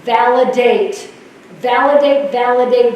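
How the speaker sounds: each validate slow, loud and punched; stressed mantra. Shown as a demonstration. Nurse leader drilling staff on validate, slow and punched. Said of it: "my psych nursing education".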